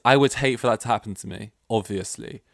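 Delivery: ordinary talk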